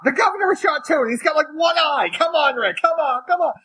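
very high shouted imitation of the wife; imitating wife's shouting. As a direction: yell at the TV the way she did. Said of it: "And I'm like".

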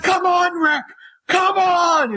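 high-pitched mock yell at the TV; imitating wife's screaming. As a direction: shout at the screen like the wife did. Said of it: "screaming at the characters".

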